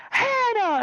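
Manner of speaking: high mock shriek of reproach; high-pitched scolding cry; scandalized teacher. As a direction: imitate a shocked teacher calling out a pupil. Teacher scolds swearing girl.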